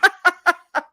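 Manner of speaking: high, loud burst of laughter; real high-pitched cackling laugh; big laugh. Real, not acted. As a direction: crack up completely. Laughs hard at the retold bit.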